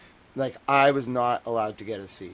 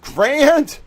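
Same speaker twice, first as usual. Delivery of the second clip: high exasperated yell; imitated yelling; 15 dB louder than usual. Editor scolds streamer about reach, imitated yelling. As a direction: mimic an editor yelling the name. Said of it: "he starts yelling".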